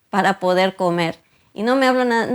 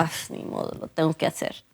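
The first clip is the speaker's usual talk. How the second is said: bad-attitude mutter; 4 semitones lower, 9 dB quieter, faster than usual. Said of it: "Una mala actitud".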